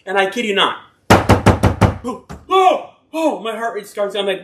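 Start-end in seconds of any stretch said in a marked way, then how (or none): 1.1-3.4 alarmed gasps. Retold or imitated. Heart racing at night.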